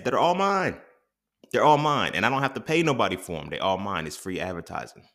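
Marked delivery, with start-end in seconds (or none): 0.0-2.1 higher and louder, proud and gloating; emphatic boast. Real, not acted. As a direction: crow it, they're all yours. Gloating over owning his ads.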